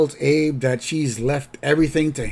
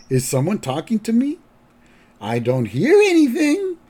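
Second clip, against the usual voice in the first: lightly voiced old man, rising higher; grandpa voice; 10 semitones higher, 4 dB louder than usual. Retold grandpa ignoring his son, lightly voiced old man. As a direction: play a mock-deaf old man, pitch climbing. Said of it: "And then Grandpa goes".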